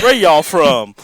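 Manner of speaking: imitating the singer hamming up y'all; lounge singer drawl. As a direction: belt it out like a schmoozy host. Quoting Nick the lounge singer.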